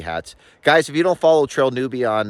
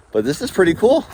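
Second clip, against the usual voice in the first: raised voice over the wind; shouting over wind; 7 semitones higher than usual. Real, not acted. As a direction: call it out over gusts. Calling out over wind to viewers.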